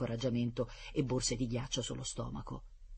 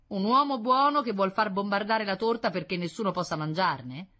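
mocking retort; 7 semitones higher, 6 dB louder than usual. Boy mocks professor's words.

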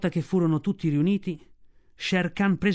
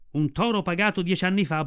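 usual speaking voice

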